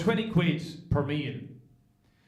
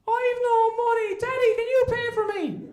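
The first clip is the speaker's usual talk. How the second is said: big son whining to daddy; childish whine; 3 dB louder than usual. Retold or imitated. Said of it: "And he says".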